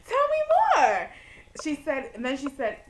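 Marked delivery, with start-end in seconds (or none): 0.1-1.1 high, loud giddy urging; eager delight. Retold or imitated. Student offered airfare and hotel, giddily eager. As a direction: beg to hear the rest, giddily. Thrilled at offer of paid trip.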